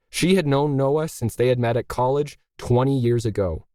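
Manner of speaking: usual speaking voice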